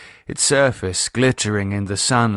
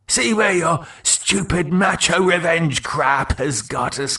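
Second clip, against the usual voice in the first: a touch of angry sniping, higher; bickering dead mates; 7 semitones higher than usual. Acted. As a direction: snipe back and forth. Mark and Keith quarrel.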